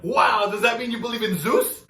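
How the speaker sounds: imitating his brother's dumb question; naive brother. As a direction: play the clueless little brother.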